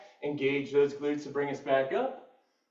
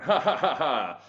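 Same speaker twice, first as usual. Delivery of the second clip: stagey, measured British "ha ha ha"; hammed-up upper-crust British chuckle; mock British laugh. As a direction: laugh like a classical British actor. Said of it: "this is the classic British tone".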